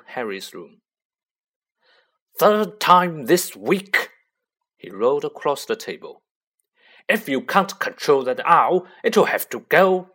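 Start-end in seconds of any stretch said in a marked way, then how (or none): 2.4-4.1 loud, slow furious bellow; angry uncle shout. Acted. Uncle shouting at nephew over owl, furious bellow. 7.1-10.1 loud, raised threat; angry uncle. Acted. Uncle warns Harry about owl, loud angry threat.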